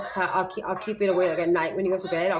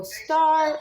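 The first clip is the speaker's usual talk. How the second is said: "Star" much higher and louder; excited high "star"; 9 semitones higher, 4 dB louder than usual. Shown as a demonstration. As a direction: give the word with big cheer. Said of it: "when I go".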